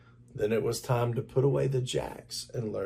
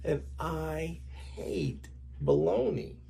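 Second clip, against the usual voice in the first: put-on slow, higher sulky kid voice; whiny child; 4 semitones higher, 6 dB quieter than usual. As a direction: drag it out like a grumpy boy.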